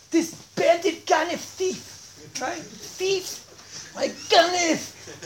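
high, slow, drawn-out accusation; mock outrage. Storyteller names Goldilocks a thief, mock outrage. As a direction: stretch each insult in disgusted disbelief. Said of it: "I don't usually get so upset".

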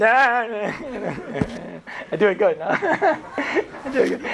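put-on slurred, giggling old drunk; laughing drunk grandpa. Drunk grandfather boasting to the grandkids, giggling. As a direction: laugh through it like a tipsy grandfather.

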